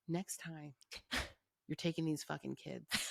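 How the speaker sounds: hushed, deliberate ultimatum with a pause; quiet ultimatum. Retold or imitated. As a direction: drop the volume for a mock threat. Retold ultimatum to her husband.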